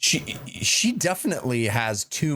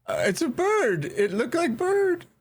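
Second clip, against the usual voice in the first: put-on high, small child voice; little kid voice. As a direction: answer like a scared toddler. Child answering stern parent boss.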